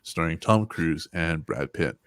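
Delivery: usual speaking voice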